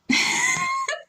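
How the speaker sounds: sudden high-pitched laugh. Real, not acted. Streamer amused by chat, high laugh.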